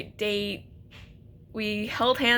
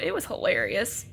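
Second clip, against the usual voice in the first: ordinary talk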